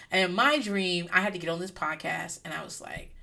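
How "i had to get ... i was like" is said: usual speaking voice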